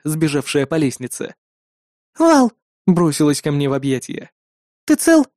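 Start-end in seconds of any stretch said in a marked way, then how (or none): 2.2-2.5 high joyful call of his name. A narrator's reading. Girl runs down, calls out. 4.8-5.3 high anxious question; worried. A narrator's reading. Anxious girl after the fight.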